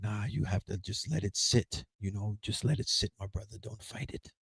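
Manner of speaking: a low, soft, soothing shaman voice; low, hushed shaman saying let it sit; calm shaman. Retold or imitated. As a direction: imitate the mellow shaman calming him.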